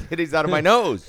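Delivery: laughing. Real, not acted. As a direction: blurt it out while cracking up. Laughing joke about sneezing.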